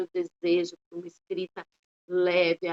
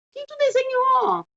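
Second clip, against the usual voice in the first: much higher, slow and bright questioning; teacher asking a child; 5 dB louder than usual. Pretend. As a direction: ask the little one sweetly what they drew. Teacher asks child about drawing. (pergunta de professora à criança, aguda e lenta)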